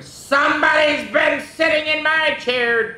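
high, forceful Papa shout; thundering. Acted. Papa finding his chair used, thundering. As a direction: thunder it out in outrage. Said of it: "thundered Papa".

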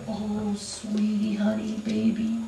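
put-on baby them with sugary words; mock-tender coddling of a friend. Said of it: "we sit and we go".

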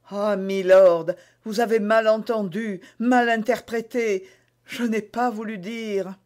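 queen trying to take back her words; flustered queen. A narrator's reading.

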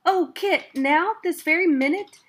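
high, loud excited squeal; thrilled child. A narrator's reading. Child thrilled Kit will teach her now, excited. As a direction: burst out with delight.

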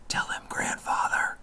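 put-on soft breathy whisper to the old man. A narrator's reading. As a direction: breathe it out so the boy barely hears. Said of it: "his mother whispered".

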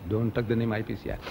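low, soft restatement; subdued, unshouted version. Retold or imitated. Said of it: "No, I didn't shout".

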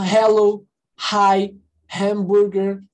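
"hello, hi, hamburger", each opened on a clear breathed H; sounded English H. Shown as a demonstration. Teacher giving English H examples, sounded H in "hello, hi, hamburger". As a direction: demonstrate the H in "hello, hi, hamburger", word by word. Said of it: "O H tem, tem som de R".

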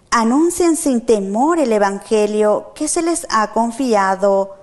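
usual speaking voice

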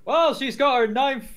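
high, loud commentary imitation; excitable commentator send-up; mock commentator. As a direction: parody an announcer harping on a record. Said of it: "pointed out on commentary".

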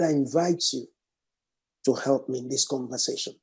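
soft low prayer; praying softly to the Spirit. Acted. Prayer invocation, softened.

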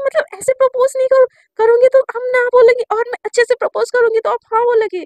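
high and loud, tripping over words; excited questioning. Retold or imitated. Girl eagerly asking her crush if she likes girls.

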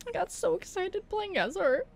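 high, weepy regret; tearful. Real, not acted. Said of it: "I am still crying".

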